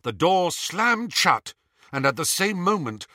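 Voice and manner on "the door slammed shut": usual speaking voice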